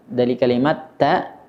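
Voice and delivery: normal talking voice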